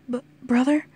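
faltering little brother voice; struggling child. A narrator's reading. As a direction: struggle out a small, rising question. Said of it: "Sealand struggled".

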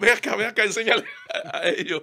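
put-on singing teacher amazed by a boy's voice. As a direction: voice the teacher's delighted surprise. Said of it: "y él me dice".

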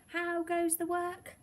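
high, drawn-out farmer's call. A narrator's reading. Farmer calling to the working duck, high drawn-out call. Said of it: "called the farmer".

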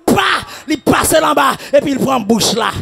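shouted, driving sermon cadence; fiery preacher in full flow; full-force preaching. Acted. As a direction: preach it hard to the congregation. Preaching the fight, chanting saisi.

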